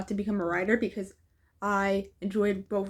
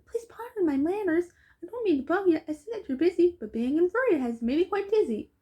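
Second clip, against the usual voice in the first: lightly voiced, a bit higher and apologetic; polite stuck stranger; 6 semitones higher than usual. A stranger politely interrupting the two busy Philippians, lightly voiced. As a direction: beg pardon gently from down in the dirt.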